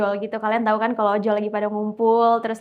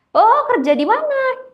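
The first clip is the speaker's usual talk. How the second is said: much higher, louder, slow and bouncy; chatty eager question; 12 semitones higher, 7 dB louder, slower than usual. Retold or imitated. Bang Jack chatting up the silent woman passenger, eager and high. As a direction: lean in, friendly and nosy.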